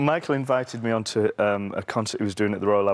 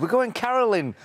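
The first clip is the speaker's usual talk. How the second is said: playful announcement; 11 semitones higher than usual. Acted. Teasing sing-song announcement.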